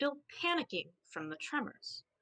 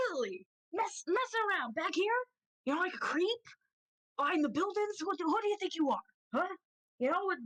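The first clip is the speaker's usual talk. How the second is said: accusing rooster; 9 semitones higher than usual. Acted. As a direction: turn the blame back on them. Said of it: "the is like".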